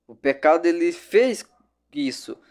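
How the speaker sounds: ordinary talk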